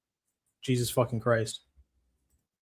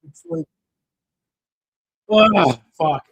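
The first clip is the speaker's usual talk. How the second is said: high laughing sigh into a louder curse; laughing in frustration; 8 semitones higher, 9 dB louder, slower than usual. Real, not acted. Streamer laughing bitterly at losing money, laughing curse. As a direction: laugh it off, then burst out. Laughing off his bet loss.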